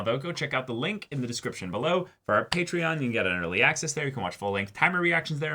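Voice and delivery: brisk upbeat promo read; outro plug. Acted. Host reads the channel outro to viewers, fast and bright. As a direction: rattle off the channel ad.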